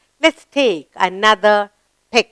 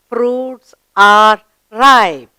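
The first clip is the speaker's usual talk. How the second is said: very slow, louder reading to read along with; slow choral reading; 6 dB louder, much slower than usual. Shown as a demonstration. Teacher leads pupils in slow reading together. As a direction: lead the class through it slowly. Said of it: "Let's read the sentence together".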